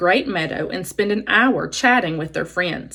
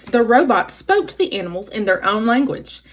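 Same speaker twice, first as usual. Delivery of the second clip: ordinary delivery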